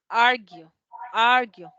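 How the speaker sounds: "argue" as AR-gyu, loud on the AR; first-syllable stress. Shown as a demonstration. Teacher showing where the accent falls, "argue" stressed AR-gyu. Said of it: "me indica dónde va el acento".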